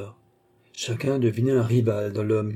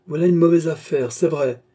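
raised, louder narrated retort; growing animated; 5 semitones higher, 6 dB louder than usual. A narrator's reading. Young man confronting the gazetteer, growing animated. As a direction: get worked up as you speak. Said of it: "s'animant au fur et à mesure qu'il parlait".

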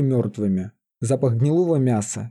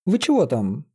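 calling out; 3 semitones higher than usual. A narrator's reading. Лиза hails her whispering companions, mildly calling out. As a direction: call out lightly to companions. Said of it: "окрикнула их Лиза".

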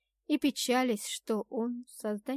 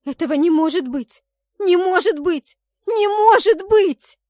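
anguished wail; 8 semitones higher, 13 dB louder than usual. A narrator's reading. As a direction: wail it from the depths of the soul. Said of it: "Мой надрывный плач шёл из самого сердца".

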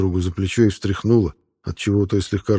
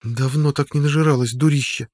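worried; 7 semitones higher than usual. A narrator's reading. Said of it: "озабоченно сказала Содия".

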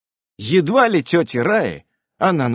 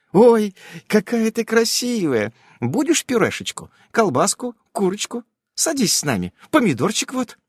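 doting neighbour; 9 semitones higher than usual. A narrator's reading. Neighbour fussing over girl.

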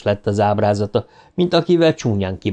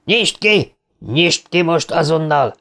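much higher, raging demand; furious yelling; 6 semitones higher, slower than usual. A narrator's reading. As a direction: bark the order over and over. Henry shaking the chain, yelling. (dühödt kiabálás)

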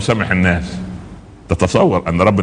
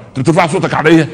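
higher-pitched mock outrage at being challenged; mock indignation; 7 semitones higher than usual. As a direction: act out an offended husband, pitched up. زوج يعاتب زوجته في شجار ممثل. (استنكار غاضب تمثيلي بنبرة أعلى)

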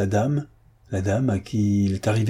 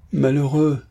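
higher, appalled exclamation; stammering dismay; 5 semitones higher, 4 dB louder than usual. A narrator's reading. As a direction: cry out in dismay. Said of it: "balbutia le concierge". (effaré, consterné)

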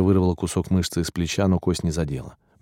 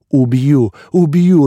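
high, loud frenzied threat; cracking squeal; 8 semitones higher, 8 dB louder, much slower than usual. A narrator's reading. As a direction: shriek it with the voice breaking, climbing higher. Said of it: "срывающийся визг".

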